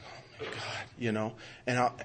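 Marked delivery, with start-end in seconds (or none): 0.0-0.8 barely voiced, very soft; retold shock, whispered under the breath; stunned whisper. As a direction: let the words almost vanish. Retells hearing baby may die.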